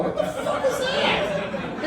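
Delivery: very high, drawn-out disbelief; high-pitched incredulous outburst; mock astonishment. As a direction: squeal it slowly in comic amazement. Mock amazement at ladies' room sofa.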